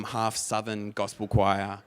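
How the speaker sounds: usual speaking voice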